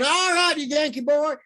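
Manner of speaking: high mocking taunt; taunting local. Bar fans in Lake Charles taunting the speaker, mocking. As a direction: rub it in like a gloating fan. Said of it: "maybe they didn't say it like that".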